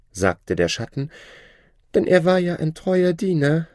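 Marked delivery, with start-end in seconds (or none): 1.9-3.7 mock-sorry shadow. The Shadow faking sorrow for the scholar, hammed-up high voice. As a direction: pretend to regret it.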